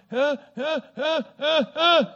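loud, high tongue-tied grunts; imitated wordless noises. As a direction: mimic straining to speak, only short grunts coming out. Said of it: "can't get a word out".

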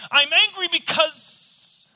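high, loud, slow; angry start. Retold or imitated. Preacher voicing the confession, loud. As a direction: shout it out. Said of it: "are willing to say".

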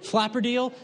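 ordinary talk

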